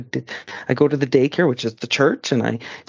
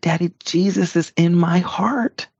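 put-on child repeating louder; 6 semitones higher, slower than usual. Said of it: "And I said".

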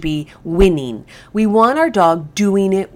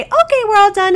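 much higher, bright puppy-party cheer; really fun voice; 5 dB louder than usual. Shown as a demonstration. Trainer hands out kibble to the dog, high excited cheer. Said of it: "I say it in a really fun voice".

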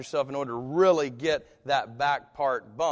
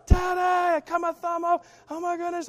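high, loud panicked cry; child screaming to dad that the thumb is gone; freaking out; 7 dB louder than usual. Retold or imitated. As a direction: shriek it like a terrified kid. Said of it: "I'm freaking out".

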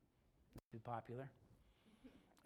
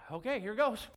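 psyched-up; 6 semitones higher, 13 dB louder than usual. Retold or imitated. Pastor nerving himself to approach.

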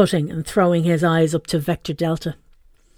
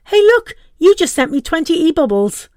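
high, loud gleeful cry; excited shout; 10 semitones higher, 7 dB louder than usual. Acted. Son shouting gleefully at mum's messages. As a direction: shout it out in delight.

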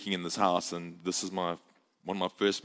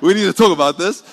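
loud, high comic exasperation; mock outrage; 9 semitones higher, 15 dB louder, faster than usual. Husband confronting his wife over toothpaste, mock-outraged and loud. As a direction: blurt it out as a comic overreaction.

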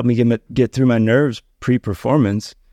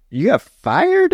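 slow, much higher shocked question; stunned disbelief; 13 semitones higher, slower than usual. Retold or imitated. Friend hearing he got fired, high and slow in shock. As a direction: let the disbelief lift the voice way up.

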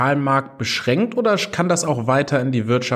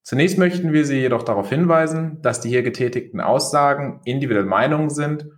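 fast legal disclaimer; 2 semitones lower, faster than usual. Acted. Recorded podcast intro disclaimer.

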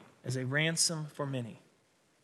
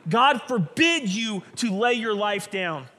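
Peter forbidding; 5 semitones higher, 6 dB louder than usual. Acted. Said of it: "he says".

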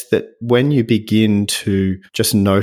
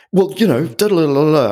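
higher, louder mock defensive teen; defensive teenage boy; 8 semitones higher, 3 dB louder than usual. To his mother, a hammed-up defensive teen brush-off. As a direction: replay the huffy teen comeback. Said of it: "I went back into that teenage boy".

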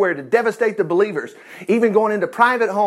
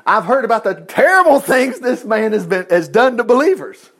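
raised, higher acted objection; exclaimed protest; 4 semitones higher, 4 dB louder than usual. Andy warns the Lord about Paul, exclaiming in protest. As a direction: protest it emphatically, pitched up and punched. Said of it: "exclaimed Andy".

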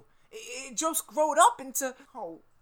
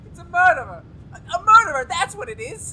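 loud, higher, frightened shout; panicked outcry; 6 semitones higher, 11 dB louder than usual. Acted. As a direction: cry out in terror about the monster. Terrified assistant warns Doc.

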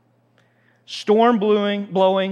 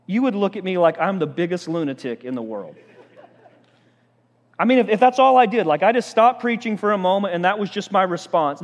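ordinary delivery

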